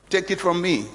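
ordinary delivery

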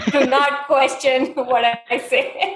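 slow, laughing show of authority; laughing mock command. Host with no clue pulls rank on co-host, laughing mock command. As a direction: playfully forbid questions through laughter.

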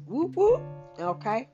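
usual speaking voice

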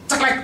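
a crisp mouth-made snip; clipper snip. Shown as a demonstration. Preacher mimicking nail clippers, crisp snip. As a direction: click it out like scissors.

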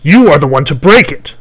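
loud, high furious crone; raging hag. Acted. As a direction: spit it out in rage. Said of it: "the hag hissed in rage".

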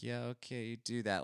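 dismissive brush-off. Retold or imitated. Brushing off salesman while closing door.

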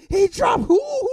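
high, loud whooping shout; celebrating. Retold or imitated. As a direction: whoop in wild excitement. Re-enacts celebrating the knockdown.

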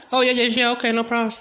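very high, loud, eager to oblige; eager gossip. Retold or imitated. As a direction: happily step aside, way up high. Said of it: "The dog was like".

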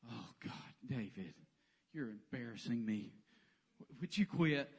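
low, muttered embarrassed groan; annoyed big brother. Retold or imitated. Older brother embarrassed by David, low muttered complaint. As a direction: grumble it like a mortified older brother. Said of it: "and he was like".

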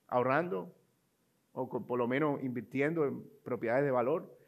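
usual speaking voice

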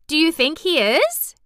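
high, loud, rising question; eager cry. A narrator's reading. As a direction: ask it with keen hope. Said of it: "cried Mary eagerly".

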